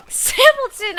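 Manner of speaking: high, loud yell of the name; calling out. Pretend. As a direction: cry out the name in mock alarm. Calling out a missing viewer.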